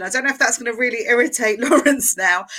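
normal talking voice

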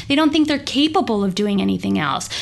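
ordinary delivery

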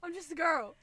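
mock helpless. Teasing repeat of the phrase.

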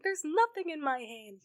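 high-pitched retold insistence; acted exasperated protest. Clara argues with the Doctor, exasperated.

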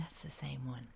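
understated stunned hush; hushed realization. Retold or imitated. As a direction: let it sink in softly.

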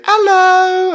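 very high, loud cartoon squeal; loud, high-pitched cartoon piglet hello; Peppa Pig. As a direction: pop up with a drawn-out, squeaky greeting. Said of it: "like Peppa the Pig".

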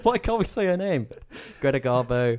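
high voice through laughter; laughing. Real, not acted. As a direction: laugh out the words. Laughing at the name slip.

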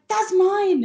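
high, loud, pleading claim; urgent claim. Retold or imitated. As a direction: fan claiming her figure back. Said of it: "I was like".

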